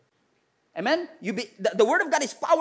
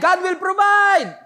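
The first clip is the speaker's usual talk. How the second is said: high, loud, slow shout; shouted declaration; 9 semitones higher, 7 dB louder, slower than usual. Shown as a demonstration. Pastor urging congregation to declare, slow loud shout. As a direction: proclaim it with authority. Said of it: "I said again".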